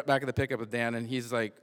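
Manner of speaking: ordinary talk, nothing marked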